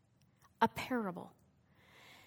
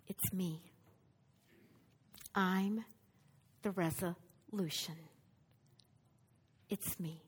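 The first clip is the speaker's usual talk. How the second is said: quiet declaration; 6 semitones lower, 6 dB quieter than usual. Acted. Preacher voicing Jesus quietly naming himself the resolution. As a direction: lower and softer, earnest.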